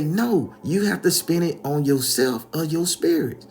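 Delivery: high, loud overruling retort; acted scolding correction; insistent grandma. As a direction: shut the argument down, loud and high.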